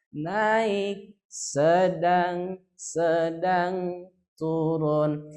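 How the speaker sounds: naik up, sedang held level, turun dropping; rising, level, falling tune. Shown as a demonstration. Teacher showing the class the four-column tune, rising, level, falling. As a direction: step the pitch up, hold it level twice, then let it fall. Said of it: "Empat kolom".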